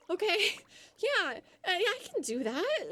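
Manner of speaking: high, forced eager yes; overly bright reluctant agreeing; fake cheerful agreement. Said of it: "and I would be like".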